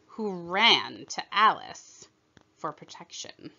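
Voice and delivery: ordinary delivery, nothing marked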